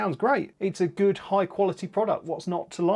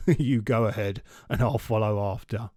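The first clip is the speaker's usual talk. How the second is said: amused Gruffalo; 6 semitones lower than usual. Acted.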